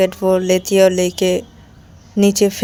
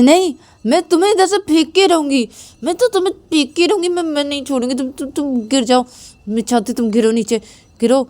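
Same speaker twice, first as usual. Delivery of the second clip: much higher, straining insistence; stubborn determination; 7 semitones higher than usual. Acted. Payal pushes Ananya off the bed, stubbornly insisting. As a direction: vow to push her off no matter what.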